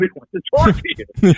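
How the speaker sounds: laughing through the line while passing on the message. Retold or imitated. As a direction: chuckle as you hand over the phone. Friend passing on the angry caller.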